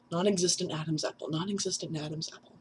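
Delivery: fast, softening repeats; tongue-twister practice. Real, not acted. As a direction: rattle it off quickly under your voice. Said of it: "That's a tongue twister".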